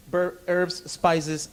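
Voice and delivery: ordinary delivery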